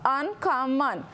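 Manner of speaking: "Uncommon" said slowly, syllable by syllable; clapped syllables. Shown as a demonstration. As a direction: clap out un-com-mon, each beat apart. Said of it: "Clap it out".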